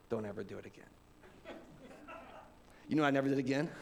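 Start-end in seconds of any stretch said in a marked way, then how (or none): 0.1-0.8 much lower and softer stern father; quiet, grave fatherly threat; stern dad warning. Retold or imitated. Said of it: "and he goes".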